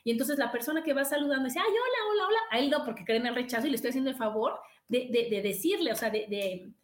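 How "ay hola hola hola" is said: much higher, bright mock hostess greeting; sing-song party hello; gushing greeting. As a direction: greet the crowd with fake sweetness. Party guest greeting others.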